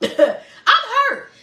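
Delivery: laughing through a high mock whine. To her audience, repeating her own quote while laughing loudly. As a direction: crack up as you repeat it.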